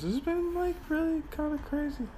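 high giddy voice; giddy and high-pitched, tripping; tripping on drugs. Real, not acted.

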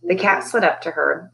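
plain reading voice

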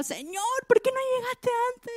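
high, indignant complaint to the Lord; mock-angry Marta scolding Jesus; angry reproach. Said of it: "como que se lo digo enojada". (reproche enojado, mucho más agudo)

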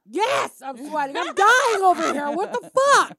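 high, loud yelling in real pain; panicked shouting. Real, not acted. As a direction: shout it out, burning up. Burning from Carolina Reaper.

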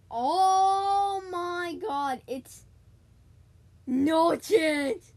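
can't believe what just happened; stunned disbelief. Real, not acted. Disbelief at another player's move.

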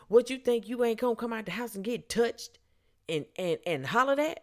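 high-pitched mock indignation; riled-up man. Drunk man demanding why she came out, mock-outraged. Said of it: "His voice is starting to raise".

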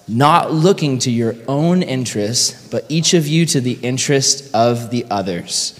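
slow, measured group reading; read aloud together. Real, not acted. Pastor leading the room in reading the verse out loud together, slowly. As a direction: lead a group recitation, unhurried and evenly spaced. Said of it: "let's read it out loud together".

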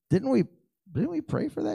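high, incredulous question; surprised. Retold or imitated. Pastor hearing the blood count is normal, high incredulous question. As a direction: act floored it came true. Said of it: "Who's more surprised than me?".

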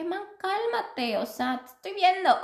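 retelling herself telling him to chill; calming him down. Retold or imitated. She calms the panicking boyfriend.

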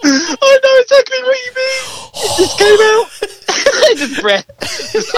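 high laughing voice; words squeezed out between laughs; giggling agreement. Real, not acted. Laughing while sympathising.

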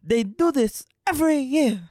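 lightly voiced whiny sloth; Sid the Sloth voice. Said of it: "Sid the Sloth".

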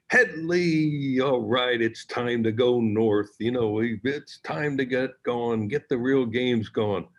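rally him, stretching the name and the words; imitating a hearty pep talk; hearty rallying call. Harry's hearty call to player.

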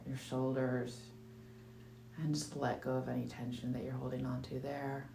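calm meditation guide with long pauses; guided meditation. Acted. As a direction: ease listeners through each breath, unhurried.

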